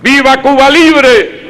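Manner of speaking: high rousing rally shout; ranting dictator speech. Real, not acted. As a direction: shout the slogan to the crowd. Consignas gritadas al final. (consigna gritada con fervor)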